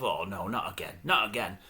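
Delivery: low, very quiet imagined groan of dismay; dismayed muttering. Acted. Guest thinking to himself on seeing the show, low dismayed mutter. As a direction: mutter it to yourself, deep and resigned.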